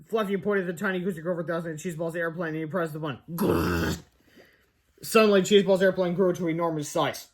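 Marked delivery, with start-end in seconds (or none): 3.3-4.0 low growling machine noise; deep rumbling buzz; zap noise. Pretend. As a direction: make the gadget rumble. Machine zaps the airplane.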